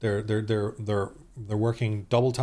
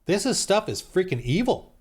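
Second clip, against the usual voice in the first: higher, stunned exclamation; shocked outburst; 7 semitones higher than usual. Retold or imitated. Reacting to a video he found, a high shocked "Damn". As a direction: let the disgust burst out. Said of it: "I'm like".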